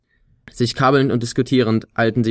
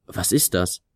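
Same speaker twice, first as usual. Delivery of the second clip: erstaunt; 2 semitones lower, 4 dB quieter than usual. A narrator's reading. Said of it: "fragte er erstaunt".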